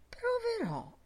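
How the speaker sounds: high, mock-solemn promise; pompously earnest; grave. A narrator's reading. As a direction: declare it with exaggerated gravity. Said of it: "disse gravemente".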